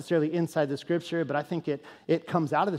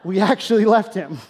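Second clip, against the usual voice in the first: louder, with a sheepish laugh; laughing confession; 4 semitones higher, 7 dB louder than usual. Retold or imitated. Pastor laughing as he admits leaving the kid. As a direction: laugh it out, a bit embarrassed.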